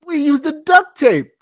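put-on kid voice. Kids answer.

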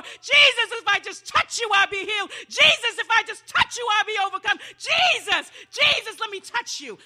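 high, very loud, mounting cry; desperate plea. Acted. Bleeding woman begging to touch Jesus, desperate high shouting. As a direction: build to shouted, pleading cries at high pitch.

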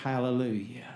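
normal talking voice

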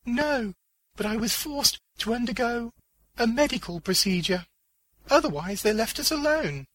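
high-pitched female character, answering; high character voice, explaining what happened; Diana. Acted. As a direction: lift the voice high for her reply.

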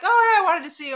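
high, loud mock-eager friend; eager greeting. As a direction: imitate a friend calling out keenly. Friend's eager greeting in retold exchange.

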